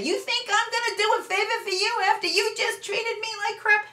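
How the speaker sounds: high sarcastic sneer, pitch way up; mock the petty parent's comeback; the wrong way to answer your teen, sarcastic and high; snide retort. Shown as a demonstration. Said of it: "You're not gonna say".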